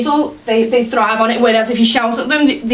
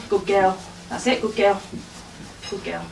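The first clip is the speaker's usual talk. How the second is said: soft praise; 3 semitones lower, 9 dB quieter than usual. Real, not acted. Praising dog after it sits.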